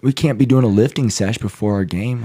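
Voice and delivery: hammed-up coach hollering at the team; mock coach yell. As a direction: bark it like a coach telling players to put the rings down. Mock yelling at players with rings.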